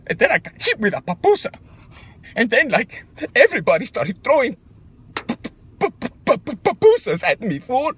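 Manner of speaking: rising indignation at the family; indignant rant. Real, not acted. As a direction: voice climbing in outrage. Indignant retelling of papusa attack.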